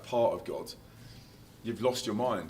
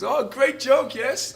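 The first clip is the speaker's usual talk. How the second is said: German guy; 10 dB louder, much slower than usual. Retold or imitated. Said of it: "he goes".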